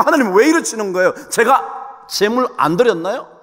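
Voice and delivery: indignant complaint to God, pitched high; acted angry protest. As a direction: protest like a wronged worshipper. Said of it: "막 화를 내는 거".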